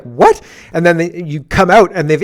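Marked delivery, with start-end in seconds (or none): 0.0-0.4 loud startled yelp; shocked what. Retold or imitated. Patient told his foot will go, shocked. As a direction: blurt it out in disbelief.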